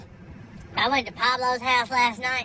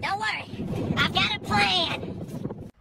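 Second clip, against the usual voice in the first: hushed scheming boss; 8 semitones higher, 4 dB quieter, much slower than usual. Acted. Boss reassures hidden worker.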